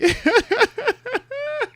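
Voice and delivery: loud, high-pitched burst of laughter; high cackle. Real, not acted. Co-host amused by his own Kelly Bundy remark, high cackle.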